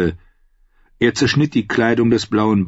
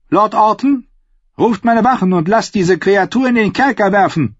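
higher, forceful royal order; outraged command; 9 semitones higher, 3 dB louder than usual. A narrator's reading. Queen has the singer thrown in jail, commanding.

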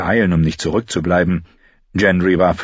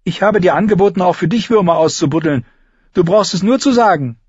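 put-on high, louder girlish retort; Arya's tart reply; 12 semitones higher, 4 dB louder than usual. A narrator's reading. Arya answers Lommi's insult, tart. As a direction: offer it back with a sharp edge.

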